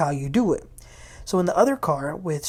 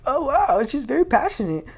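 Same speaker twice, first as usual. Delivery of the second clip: taken aback; 7 semitones higher than usual. Retold or imitated. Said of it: "I was like".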